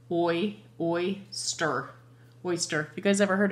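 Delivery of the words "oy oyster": sounding out. Shown as a demonstration. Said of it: "Can you read that, guys?".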